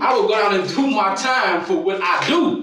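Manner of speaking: loud and slow, hammering each word; defiant shout. Real, not acted. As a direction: shout it out, daring them to charge you. Angry suspect in police interrogation.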